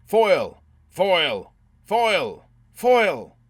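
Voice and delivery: high, very loud repeated calls; shouting. A narrator's reading. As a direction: bellow the name like a squad of raiders.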